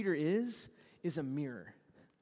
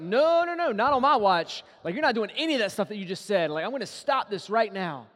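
high loud no-no-no, rushing faster at the end; loud protest; 3 semitones higher, 8 dB louder than usual. Retold or imitated. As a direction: blurt it out to stop him. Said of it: "He steps up and is like".

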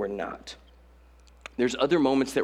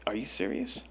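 low-key, much softer deadpan annoyance; annoyed; 3 semitones lower, 9 dB quieter than usual. Retold or imitated. Student to a panicking leader in the water, annoyed. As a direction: imitate an unimpressed student under the breath. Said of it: "there's a student just so annoyed".

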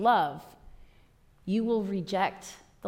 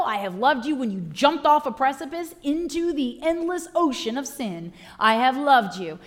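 loud, rising preached litany of love; preaching at full force; 4 semitones higher, 6 dB louder than usual. Acted. Preacher voicing Jesus to his sinful listeners, full-force preaching. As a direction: preach each line harder, building.

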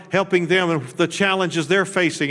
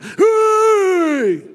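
high, loud mock stadium whoop "Hey!"; hollering like a painted-face fan in the stands; mock fan yell; 8 dB louder than usual. Preacher imitating a Cardinals fan cheering at the game, mock yell. Fans yelling at a ballgame.

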